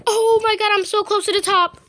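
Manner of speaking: breathless near the finish; excited. Real, not acted. Excited climbing near top.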